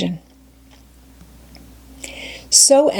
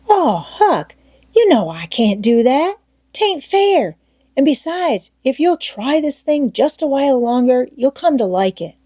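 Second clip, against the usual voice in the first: put-on higher, eager boy's voice coaxing his friend; Tom Sawyer's voice; 6 semitones higher than usual. Tom coaxing Huck to stay, eager boyish voice. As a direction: pitch up into a keen young boy.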